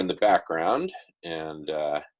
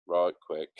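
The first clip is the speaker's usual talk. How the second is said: ordinary delivery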